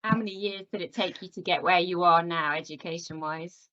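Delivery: ordinary talk, nothing marked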